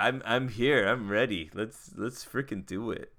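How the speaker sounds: ordinary delivery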